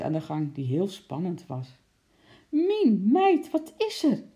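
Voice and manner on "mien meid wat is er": high, raised, startled question; alarmed call. A narrator's reading. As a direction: call out to your wife in alarm. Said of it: "riep de kok". (geschrokken roepend)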